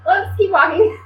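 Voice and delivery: high, breezy mock dismissal; high playful dodge of the proposal; mock brush-off. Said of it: "remember being like".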